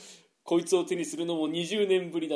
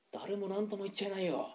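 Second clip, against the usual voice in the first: much softer muttered aside; 14 dB quieter than usual. Acted. Gambler muttering to a friend, much softer. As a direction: mutter it under your breath.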